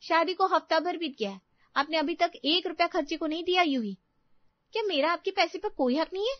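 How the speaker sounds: high-pitched indignant accusation; wife's shrill-edged marital complaint; flaring up. Acted. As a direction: lash out at the husband, aggrieved. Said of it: "अपने पति UV पे भड़क उठती है".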